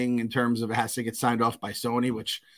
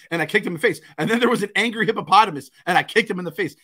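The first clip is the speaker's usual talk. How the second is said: higher, lively imitation of a boy; kid recounting nightmare; 6 semitones higher, 4 dB louder, faster than usual. Son telling dad about nightmare fights, animated kid imitation. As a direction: proudly voice a little kid's story. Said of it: "He's like".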